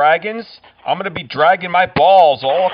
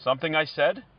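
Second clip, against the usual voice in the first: polite; 8 dB quieter than usual. Acted. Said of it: "That's the polite version of me".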